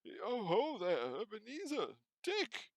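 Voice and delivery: put-on old Fezziwig calling his apprentices; rich fat jovial voice. A narrator's reading. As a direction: call out like a jolly old master. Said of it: "rich, fat, jovial voice".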